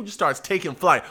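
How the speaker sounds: ordinary talk, nothing marked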